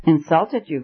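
ordinary delivery, nothing marked

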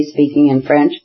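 usual speaking voice

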